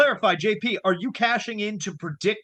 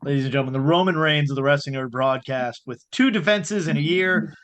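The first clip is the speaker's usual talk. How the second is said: announcer voice; 6 semitones lower than usual. Acted. Mock announcement of the champion.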